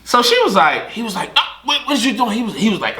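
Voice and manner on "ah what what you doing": put-on high panicky boyfriend voice; hammed-up man pleading; mock alarmed boyfriend.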